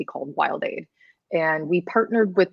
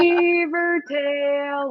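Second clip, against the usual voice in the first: high drawn-out yodel call; 9 semitones higher, much slower than usual. Pretend. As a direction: stretch it like an alpine yodel. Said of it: "like Ricola, but yelling beaver tail".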